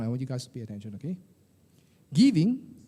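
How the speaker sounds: usual speaking voice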